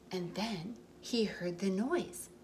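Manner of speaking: gentle storybook read-aloud; reading to children. A narrator's reading. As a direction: tell it like a bedtime story for little ones. Reading picture book aloud.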